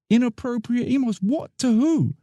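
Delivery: high, incredulous yelp of protest; stunned by the accusation, voice jumps up; shocked disbelief. Acted. Worker accused by supervisor, shocked and high.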